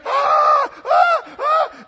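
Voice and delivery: very high, loud repeated screams; imitated kids screaming. Kids trapped with a flying bat, imitated screaming. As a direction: shriek in bursts like panicking children. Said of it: "And the kids are like".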